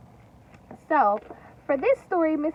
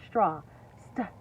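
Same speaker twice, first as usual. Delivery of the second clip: plain reading voice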